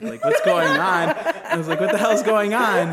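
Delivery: slow, laughing 'what the hell' at a baffling call; laughing disbelief. Retold or imitated. Baffled by the caller.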